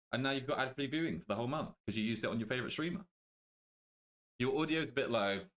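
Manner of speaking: normal talking voice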